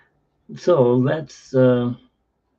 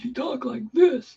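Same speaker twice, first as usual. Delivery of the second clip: much higher and slower, mimicking a silly voice; imitating his voice; 12 semitones higher, slower than usual. Boy mocking mom's boyfriend, high silly voice. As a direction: ape the guy's high voice slowly. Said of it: "because he had this voice".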